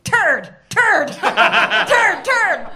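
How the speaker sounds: honked turd, turd, turd, breaking into a laugh; mock car horn. Comedian voicing a Mercedes horn as a silly honk.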